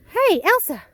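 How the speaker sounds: high, loud call across the yard; calling out to the dog. Real, not acted. Owner calling her dog Elsa out of the water, loud high call. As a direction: shout her name to get her attention.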